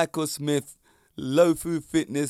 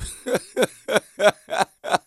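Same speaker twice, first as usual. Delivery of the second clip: a big burst of laughter, high and loud; 7 semitones higher, 5 dB louder than usual. Real, not acted. Podcaster laughing hard at his own yawn joke. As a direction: let a real laugh burst out.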